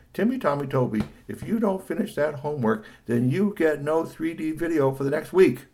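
a touch of mum laying down the law; stern mother. Acted. Mother warns son about losing video, mildly stern.

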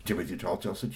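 plain reading voice

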